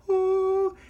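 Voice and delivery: a sudden high, breezy "Oh"; casual mock oh. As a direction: toss it off like it's nothing, squeaking up high. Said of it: "The guy's just like".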